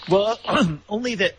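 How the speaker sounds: ordinary delivery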